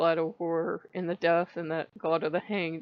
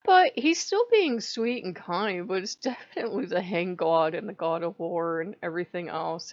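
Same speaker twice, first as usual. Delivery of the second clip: ordinary delivery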